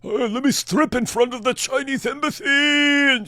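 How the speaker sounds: high, slow, loud mocking bravado; hammed-up high mocking voice; mock show-off. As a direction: parody a clout-chasing stunt boast. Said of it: "That's who they listened to".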